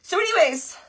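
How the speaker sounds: normal talking voice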